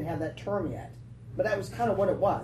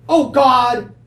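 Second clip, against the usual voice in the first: loud, raised wail of self-pity; Jonah crying out; 4 semitones higher, 13 dB louder than usual. Acted. Jonah wallowing in self-pity, loud outcry. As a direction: cry out loudly in complaint. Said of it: "crying out".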